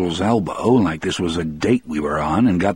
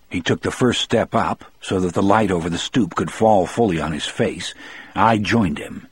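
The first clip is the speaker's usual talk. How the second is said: plain reading voice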